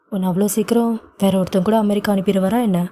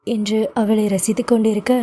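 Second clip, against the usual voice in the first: ordinary delivery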